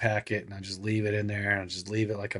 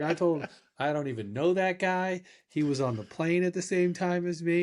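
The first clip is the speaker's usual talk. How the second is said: higher-pitched imitation of her denial; lightly voiced girlfriend; 8 semitones higher than usual. Girlfriend explaining what she told officer, lightly imitated. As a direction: disown him in a lightly put-on higher voice.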